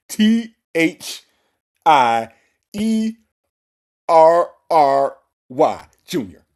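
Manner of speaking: loud, drawn-out letters with long pauses; exaggerated letter-by-letter mock spelling; mocking his spelling. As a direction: spell it slowly and heavily, imitating him. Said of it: "That's how he said it".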